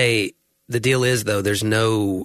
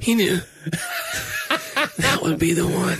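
high, wobbly fake-tearful voice; hammed-up tearful gush; mock choked-up. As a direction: ham up the emotion, voice cracking high. Teasing mock emotion.

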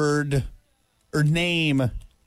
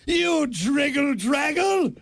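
mock insult shout; 8 semitones higher, slower than usual. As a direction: fling it like an offended gentleman. Acting out old insults.

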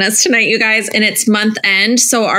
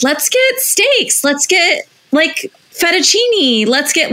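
eager suggestions; 8 semitones higher, slower than usual. Retold or imitated. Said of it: "I was like".

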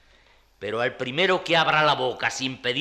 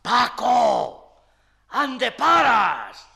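shouting down the stairwell; calling out; 11 semitones higher, 6 dB louder, much slower than usual. Acted. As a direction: call out loudly.

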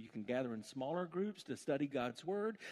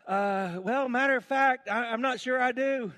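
hesitant eunuch; 11 semitones higher, 12 dB louder than usual. Acted. Voiced eunuch answering.

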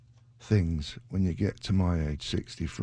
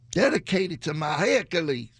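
high, silly squeaky voice; comic falsetto; 8 semitones higher than usual. Pretend. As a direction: squeal a mock dedication. Comic voice for his injured Achilles.

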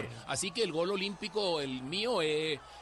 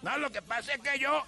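much higher character voice; second voice; 9 semitones higher than usual. Retold or imitated. One character of the DJ's talking to Magolito, pitched up. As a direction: play the other guy, pitched up. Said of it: "él mismo hacía todos los personajes".

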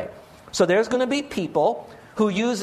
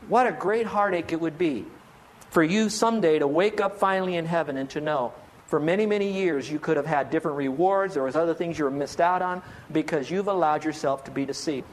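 ordinary talk, nothing marked

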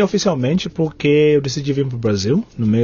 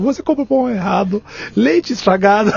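retold complaint laughing; 6 semitones higher than usual. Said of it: "Eu falei assim".

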